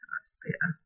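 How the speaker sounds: whispered under the breath; soft relieved whisper; whispered relief. Real, not acted. Relieved aside after placing gem.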